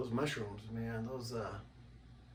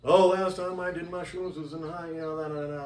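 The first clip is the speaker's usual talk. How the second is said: higher, louder mock-casual voice; mocking partygoer; 6 semitones higher, 10 dB louder than usual. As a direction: lampoon the casual mushroom user. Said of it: "they're like".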